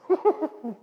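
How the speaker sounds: high, bubbling stage giggle; performed fit of giggles; acted giggle. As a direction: giggle helplessly in character. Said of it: "I'm, I'm giggling".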